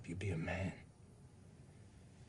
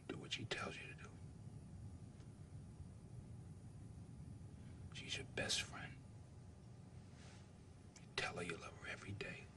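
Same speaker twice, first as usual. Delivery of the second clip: soft unvoiced whisper at a bedside; hushed whisper so the boy can rest; 7 dB quieter than usual. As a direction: breathe the words to a sick child. Father at dying son's bed.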